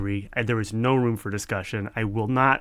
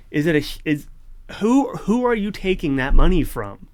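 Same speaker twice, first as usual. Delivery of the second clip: higher, louder indignant demand; righteous anger; 6 semitones higher, 5 dB louder than usual. Real, not acted. Ranting at the paywalled library's maker, real righteous anger. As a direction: call them out, genuinely fed up. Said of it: "That was some good righteous anger".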